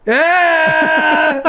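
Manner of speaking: high, drawn-out mock cheer; squeaky imitation of forced excitement; awkward fake enthusiasm. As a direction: overdo the upbeat reply. Retold overdone upbeat reply.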